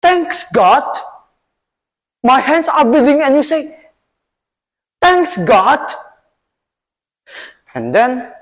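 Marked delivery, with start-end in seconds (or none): 0.0-5.9 high, loud outraged retort; a bleeding king shouting at his calm advisor; furious king. Acted. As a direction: throw his words back at him in disbelief. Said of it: "his face turned into red".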